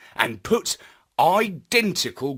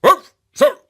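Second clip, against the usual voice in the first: much higher, loud barked reply; dog sergeant; 12 semitones higher, 11 dB louder than usual. A narrator's reading. Robo-dog sergeant answering Marlowe, loud and barked. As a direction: bark it out like a dog soldier.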